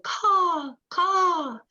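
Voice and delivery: high cawing crow call. Acted. Crow sound in story.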